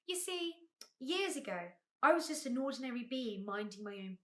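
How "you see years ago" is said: ordinary delivery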